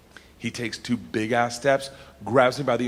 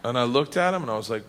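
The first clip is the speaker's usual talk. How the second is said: ordinary talk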